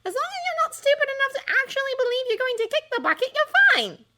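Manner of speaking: put-on brainy girl smugly correcting Ron; superior tone. A narrator's reading. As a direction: talk down to him. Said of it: "in a superior tone".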